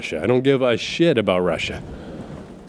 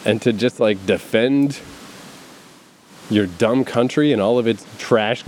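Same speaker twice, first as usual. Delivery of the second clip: ordinary talk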